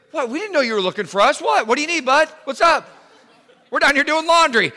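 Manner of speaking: high-pitched, casual fake-innocent brother; mock-innocent, quick and high; feigned innocence.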